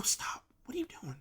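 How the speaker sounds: whispered, half asleep, pleading to stop; hushed whisper. Retold or imitated. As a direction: breathe the words out like someone barely awake. Sleepy retold complaint at night.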